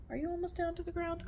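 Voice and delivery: high, quiet, slightly quick question; soft pet talk. Real, not acted. Owner checking on the snake she lowers, soft pet talk. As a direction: coo a soft question to an animal.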